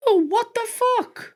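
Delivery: put-on high, slow squeaky child's swear; mimicking his little son swearing at a game; kid's high voice. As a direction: squeak it like a small boy. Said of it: "I hears".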